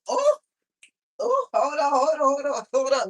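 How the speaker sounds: strained cries from sore knees; kneeling down. Real, not acted. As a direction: yelp as the knees give. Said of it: "stretch out my knees".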